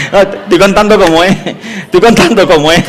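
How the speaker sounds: laughing through the denial. Real, not acted. Preacher insists the story is true, laughing. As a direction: protest while laughing. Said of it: "soy medio teatrero".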